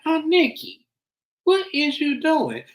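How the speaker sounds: slow, very high mock dismay; high-pitched disbelief. Host reacting to the meddling aunt, slow high-pitched disbelief. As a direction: draw it out high, scolding in disbelief.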